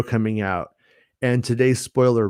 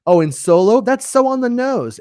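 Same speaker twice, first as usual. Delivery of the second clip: much higher, incredulous mock questioning; scoffing imagined critics; 11 semitones higher, 3 dB louder than usual. Other half of viewers judge the name Han Solo, scoffing. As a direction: play the unimpressed audience, pitched way up. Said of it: "The other h-, half would be like".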